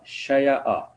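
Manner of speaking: شیعہ said as sha-ya-a, with a clear y in the middle; original form shown. Shown as a demonstration. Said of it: "یہ اصل میں کیا تھا؟". (شیعہ کو شَ-یَ-أَ، یا واضح کر کے)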